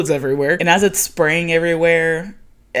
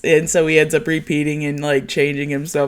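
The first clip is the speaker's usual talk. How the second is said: normal talking voice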